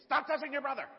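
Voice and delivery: high loud scolding mother; scolding mom. Retold or imitated. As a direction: snap at the kids like a fed-up mom. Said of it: "And Mom would say".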